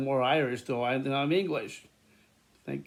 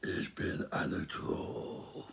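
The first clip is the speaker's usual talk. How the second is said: deep, slow, whispered troll croak; 8 semitones lower, 10 dB quieter, much slower than usual. Pretend. Vlogger doing a deep whispered troll voice. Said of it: "I just said".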